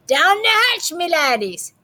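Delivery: bang the table and yell; captain yelling. A narrator's reading. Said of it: "pounded his fist on the table and yelled".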